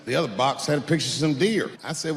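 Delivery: ordinary talk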